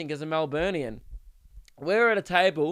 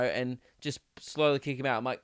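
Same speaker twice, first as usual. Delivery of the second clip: ordinary talk, nothing marked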